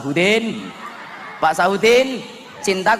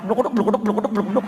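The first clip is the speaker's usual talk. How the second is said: higher, rhythmic mock thumps; pounding sound effect; 4 semitones higher than usual. Shown as a demonstration. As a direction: imitate being hit, bluk after bluk. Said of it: "kayak gini".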